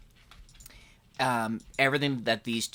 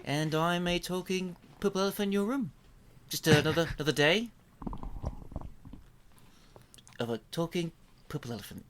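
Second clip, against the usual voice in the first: soft, hesitant New Zealand accent; Taika Waititi; 3 semitones higher, 3 dB quieter, faster than usual. Shown as a demonstration. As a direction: voice a toy elephant in a mild New Zealand accent. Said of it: "kinda has a Taika Waititi, like, New Zealand type thing".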